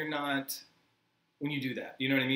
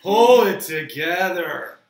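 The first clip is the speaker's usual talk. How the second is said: exasperated; 13 dB louder, much slower than usual. Pretend. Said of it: "exasperated plea".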